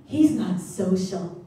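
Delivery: slow, clipped mock disapproval; mock offended mother pulling her kid away; huffy mom. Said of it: "grabs her and goes".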